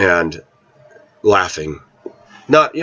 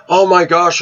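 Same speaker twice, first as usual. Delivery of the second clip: a sudden realization, pitched up; surprised; 9 semitones higher than usual. Retold or imitated. Caught out missing the nearby restaurant, surprised exclaim.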